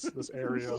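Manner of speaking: ordinary talk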